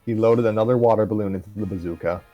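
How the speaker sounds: ordinary delivery, nothing marked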